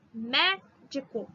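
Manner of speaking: "Médico" slow, "Mé" hit hard; stress on MÉ. Shown as a demonstration. Teacher showing students the stressed syllable. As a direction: lean on "Mé" in "Médico". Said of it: "Mé é a mais forte".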